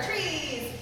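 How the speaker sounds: normal talking voice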